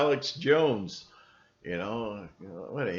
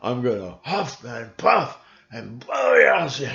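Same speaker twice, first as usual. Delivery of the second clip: loud, lightly voiced wolf threat; mock big bad wolf; 8 dB louder than usual. Trump pictured as the wolf, lightly voiced louder threat. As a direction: huff it out like the wolf. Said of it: "He is the wolf".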